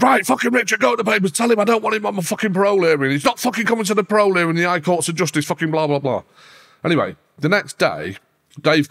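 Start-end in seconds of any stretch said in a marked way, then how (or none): 0.0-6.2 angry Charlie. Retold or imitated. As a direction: fire it off like furious Charlie. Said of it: "Charlie said".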